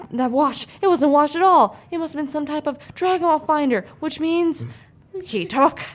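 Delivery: ordinary delivery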